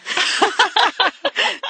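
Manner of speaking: sudden high-pitched laugh; high burst of laughter. Real, not acted. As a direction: cracks up laughing. Laughs at own joke.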